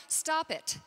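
usual speaking voice

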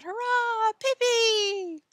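high, loud drawn-out hurrah; shouted cheer. A narrator's reading. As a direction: roar for the winner like a crowd.

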